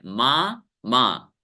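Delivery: माँ, माँ as two separate beats with a clear pause; split into syllables. Shown as a demonstration. Teacher splits a word in two for class, separate syllables. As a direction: break it into two pieces, माँ then माँ. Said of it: "दो टुकड़े में बोला जा रहा है".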